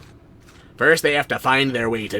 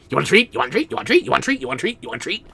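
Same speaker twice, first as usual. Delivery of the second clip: put-on doggy baby talk; 11 semitones higher, much faster than usual. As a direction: tease the dog with a treat, climbing up. Teasing dog with treat.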